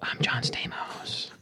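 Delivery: secret whisper, slow and breathless. Pretend. Jokingly claims to be famous.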